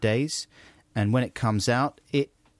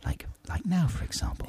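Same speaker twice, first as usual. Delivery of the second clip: soft whisper; whisper demo; 6 semitones higher, 6 dB quieter than usual. Shown as a demonstration. Showing the listener how they whisper, soft whisper. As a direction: drop to a whisper. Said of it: "get down to a whisper".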